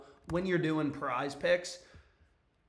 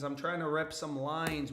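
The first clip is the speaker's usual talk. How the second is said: usual speaking voice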